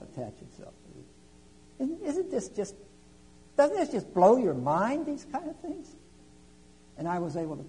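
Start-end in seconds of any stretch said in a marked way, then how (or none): 1.8-6.0 much higher, slow, marvelling; amazed. Real, not acted. Preacher asks the congregation to marvel, rising amazement. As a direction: let the wonder lift the pitch.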